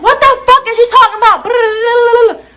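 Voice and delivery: high, slow indignant shout; outraged yell. Retold or imitated. Student yelling back at her accuser, high drawn-out outrage. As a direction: stretch it out in high-pitched disbelief.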